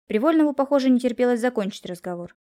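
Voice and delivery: usual speaking voice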